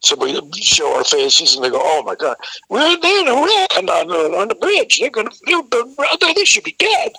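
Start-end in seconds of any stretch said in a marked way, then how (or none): 1.8-7.1 high, mock-panicked babble; hammed-up alarm, pitched way up; alarmed astonishment. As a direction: stumble over the words in shocked imitation. Security alarmed about the wreck.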